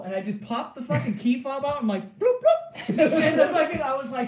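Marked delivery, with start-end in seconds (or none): 2.2-2.9 high, loud "Bloop, bloop" beeps through a laugh; key fob chirp. Retold or imitated. Unlocking the convertible for the sergeant, high laughing fob beeps. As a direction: chirp like a car remote, laughing.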